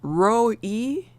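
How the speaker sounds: "row E" in two parts, row as in row your boat; sounded-out pronunciation. Shown as a demonstration. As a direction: say "row E" slowly as a question, then a long ee. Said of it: "Like row your boat?".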